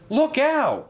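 very high warning cry; cried out in alarm. A narrator's reading. Thomas warns of the speeding bus, crying out. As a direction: warn them urgently, voice leaping up. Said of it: "cried Thomas".

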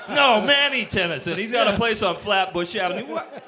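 high, loud, laughing through the quote; can't get the words out for laughing; retelling the joke while cracking up. Retold or imitated. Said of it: "that's the way it started".

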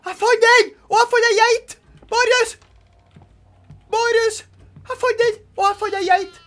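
high, very loud yelling to a teammate; gasp, then loud high-pitched cries; excited shouting. Real, not acted. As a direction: shout the discovery in wild excitement. Excited find, calling teammate Marius.

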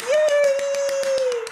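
high joyful yay; excited fan cheering. Real, not acted. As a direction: cheer like at the concert. Cheering end of performance.

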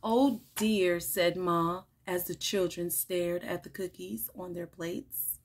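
animated picture-book read-aloud with lively character lines; reading to children. Acted. Teacher reading a picture book to children, bright and animated. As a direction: read it like story time for little kids.

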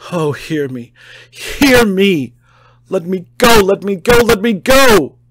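high, loud desperate shouting; frantic shouted pleading. A narrator's reading. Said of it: "torrent of entreaty".

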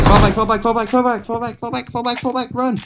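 shouting orders over battle. Real, not acted. Retreat call in firefight.

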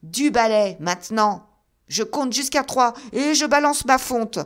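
high, loud threat; shouted ultimatum. A narrator's reading. Sherman threatening to throw his iron, shouted ultimatum. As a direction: count it off as a threat.

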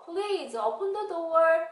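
high, slow, lilting request; child's polite plea. Acted. Child asking mom to open up, high lilting plea. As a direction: stretch it out, asking to be let in.

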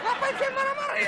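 laughing disbelief. Acted. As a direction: laugh at yourself for getting angry. Promoter realizes event is indoor.

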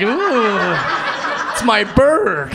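slow, laughing, proud of the bird; smiling proud. Retold or imitated. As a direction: savor it with a grin. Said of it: "me just smiling like".

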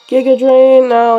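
normal talking voice

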